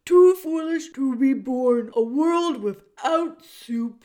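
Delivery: put-on loud, booming old jailer who speaks of himself by name; the jailer Gregory greeting Meg in a big theatrical voice. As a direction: boom it out like a grand old jailer. Gregory laments soup.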